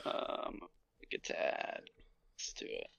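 whispering. Real, not acted. Unvoiced aside.

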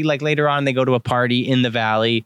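ordinary talk

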